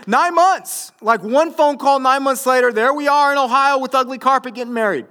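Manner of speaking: very high, loud, excited proclamation; emphatic shout. Real, not acted. Pastor telling doubters how he got married, emphatic shout. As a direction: hammer the story home at full voice.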